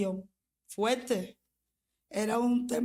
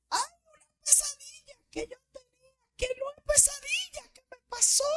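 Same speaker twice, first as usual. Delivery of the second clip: much higher, tearful, trembling explanation; retold tearful answer. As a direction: answer the sister-in-law while crying.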